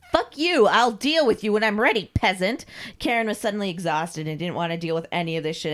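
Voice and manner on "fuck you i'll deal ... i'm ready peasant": loud, high, dropping to contempt; angry outburst. Acted. Mistress puts off nagging Carl, furious. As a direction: explode at a pestering servant.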